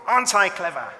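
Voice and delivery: loud, high, cocky show-off; boastful monkey. A narrator's reading. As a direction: crow it out proudly.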